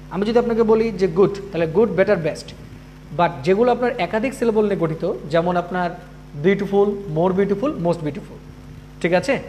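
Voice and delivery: usual speaking voice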